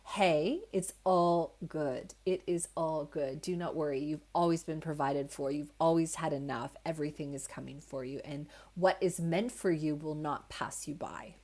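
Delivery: soft, low, calm reassurance; wise inner voice. Acted. Wise heart voice reassuring yourself when you worry, calm and kind. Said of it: "that kind of wise voice".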